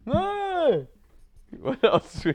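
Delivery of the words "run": high-pitched yell. Pretend. Continues Wire scene yelling.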